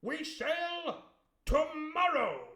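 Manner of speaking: put-on the squire spluttering at the boy, high and loud; blustering squire. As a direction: splutter like a flustered gentleman. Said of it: "says he".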